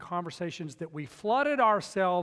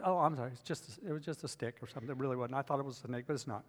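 sheepish relief; 5 semitones lower, 8 dB quieter, faster than usual. Retold or imitated. Sheepish realization, stick not snake.

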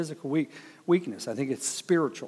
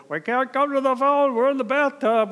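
high, louder frantic cry from the tub; hiding in the bathtub from the hurricane, high panicked shout; panicked husband; 12 semitones higher, 6 dB louder than usual. Retold or imitated. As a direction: call out high and frantic through the door. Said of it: "He goes".

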